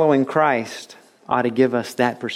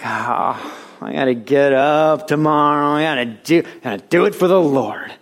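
mock dread. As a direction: drag the words out like a dreaded chore. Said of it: "almost as bad as going to the dentist".

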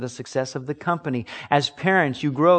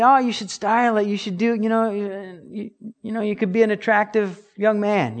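higher, lightly sing-song imitation of advisers; mock nagging adults; 7 semitones higher than usual. Adults telling a teen to style his hair, light mock. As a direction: parody the well-meaning grown-ups.

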